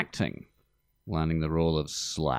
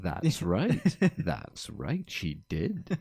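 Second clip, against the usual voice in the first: mimicked agreement; 3 dB quieter, much slower than usual. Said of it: "You just said".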